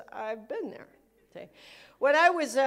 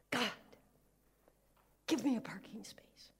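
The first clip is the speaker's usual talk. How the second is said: hushed, breathless whisper to God; whispered mock prayer; 8 dB quieter than usual. Preacher mocking a parking-space prayer, whispered. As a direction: whisper it like a secret wish.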